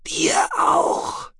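a breathy, half-whispered alien voice; Shallowayne's voice. A narrator's reading. Shallowayne telling Tech it is too late, in a hushed alien whisper. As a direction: hold him in a hushed, husky whisper.

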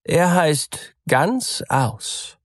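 the name Ganz aus drawn out slowly and high; slow sly reveal. A narrator's reading. Cat telling the mouse the name, drawn out slowly. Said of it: "sagte die Katze".

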